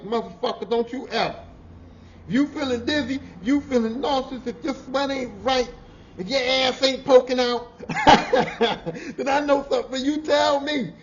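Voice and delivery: high, loud stern warning with a laugh; retold scolding. Boss warning worker about heat, loud half-laughing scolding. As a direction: lay down the law loudly. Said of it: "I was like".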